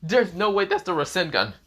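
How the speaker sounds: high-pitched disbelief; mock incredulous outburst. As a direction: blurt it out high, as if amused. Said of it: "Sanji is bursting out laughing and saying".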